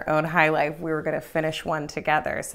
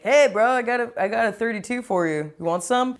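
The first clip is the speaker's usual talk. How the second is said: lightly voiced casual bro offer; lifted, easy sales pitch to a bro; friend baiting the prank; 4 semitones higher than usual. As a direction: offer it like a chummy guy. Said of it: "Noonan's all like".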